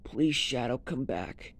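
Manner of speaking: normal talking voice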